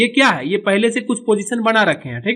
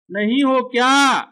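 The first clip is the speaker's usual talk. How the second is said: slow, raised calling voice; animated character calling; 5 semitones higher, 3 dB louder, slower than usual. Acted. Character searching for someone, slow drawn-out calling. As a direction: stretch the question out, pitched up. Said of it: "अब ये बोलेगा".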